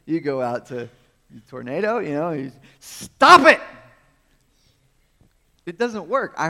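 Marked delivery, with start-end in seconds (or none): 2.8-3.6 loud yelled command at a storm; mock shout. Mock-yelling at the tornado to stop.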